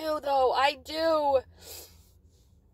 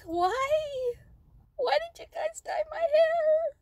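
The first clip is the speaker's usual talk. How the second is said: crying out the question, voice cracking; 10 semitones higher, slower than usual. Real, not acted. Tearful vlogger asking friends about her hair, crying. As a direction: voice breaking high with tears.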